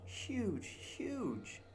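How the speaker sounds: huge repeated far off and high; cave echo. Shown as a demonstration. The cave walls answering Jasper, distant echo. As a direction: let huge fade back as an echo. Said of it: "Oh, it's echoes".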